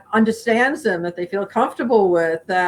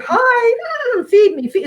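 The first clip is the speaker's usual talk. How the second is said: much higher, whinny-like animal pleading; playful horse impression; 12 semitones higher than usual. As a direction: beg for breakfast like a hungry horse. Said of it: "Horses are whinnying".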